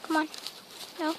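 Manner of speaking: ordinary talk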